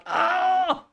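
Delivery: sharp high yelp of realization; surprised yelp. Real, not acted. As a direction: let out a sudden loud "ah". Realizes a coding mistake.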